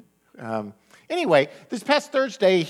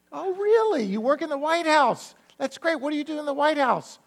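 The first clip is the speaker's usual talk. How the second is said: mock surprise; 14 semitones higher than usual. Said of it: "And he goes".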